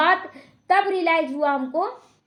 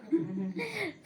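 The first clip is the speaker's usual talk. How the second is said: quiet amused chuckle; soft laugh; 4 semitones higher, 13 dB quieter than usual. Real, not acted. Speaker recalls being told to leave once more, soft laugh. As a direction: laugh softly to yourself.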